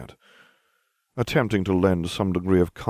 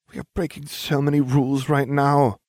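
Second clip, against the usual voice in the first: higher, anxious worrying; fretting; 7 semitones higher, 3 dB louder than usual. A narrator's reading. Hermione worries to her friends about breaking rules, fretting. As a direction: fret aloud about the rules. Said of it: "Hermione fretted".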